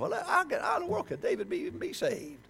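high-pitched scandalized churchgoer; mock incredulity. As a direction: question it in exaggerated disbelief. Voices an incredulous hearer of David's sin.

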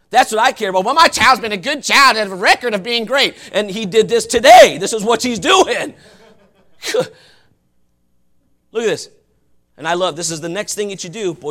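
ordinary delivery

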